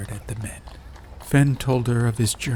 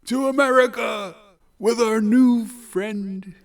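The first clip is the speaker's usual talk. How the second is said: loud, high, drawn-out cheer; joyful yell; 14 semitones higher, 5 dB louder than usual. A narrator's reading. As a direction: shout it out with joy. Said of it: "Duroc yelled with joy".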